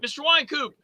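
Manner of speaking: mock distant hail; retold calling out. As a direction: call out as if across a street. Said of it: "A guy from across the street".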